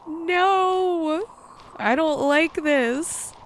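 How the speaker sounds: high, slow frightened whine; scared whine. Real, not acted. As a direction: plead in fear, dragging it out. Horror chase reaction.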